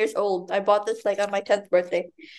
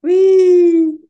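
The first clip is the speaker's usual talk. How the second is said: high, loud whee; gleeful; 8 semitones higher, 10 dB louder than usual. Real, not acted. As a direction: squeal it out with glee. Gleeful shout at the book.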